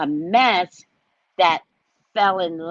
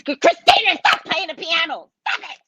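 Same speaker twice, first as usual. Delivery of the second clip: high, yelled reprimand; hammed-up shrieked order; frantic mother scolding; 11 semitones higher, 4 dB louder than usual. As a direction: act out a mother at her wits' end. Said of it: "the mother comes over".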